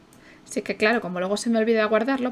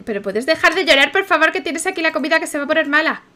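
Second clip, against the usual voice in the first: louder, higher pleading; exasperated pleading; 5 semitones higher, 7 dB louder than usual. Acted. Begging the crying child to eat, exasperated and louder. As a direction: beg the kid to stop and eat.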